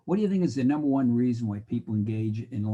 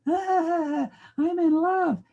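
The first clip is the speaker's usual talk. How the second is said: much higher dreamy swooning voice; lovesick student; 5 dB louder, much slower than usual. Acted. Skipping student tells the professor he's in love, swooning. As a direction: sigh it out, head in the clouds.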